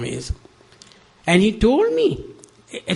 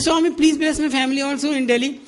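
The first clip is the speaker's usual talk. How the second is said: eager plea; 10 semitones higher, faster than usual. Retold or imitated. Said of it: "I'm shouting".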